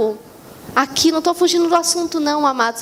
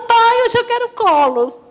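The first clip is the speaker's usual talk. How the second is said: put-on a touch of pouty little-girl whine; whiny, wanting a cuddle; 5 semitones higher than usual. Preacher voicing herself asking God for a cuddle, mildly whiny. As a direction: beg Dad to be held, mildly pouty. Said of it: "Tem dia que eu tô tão manhosa".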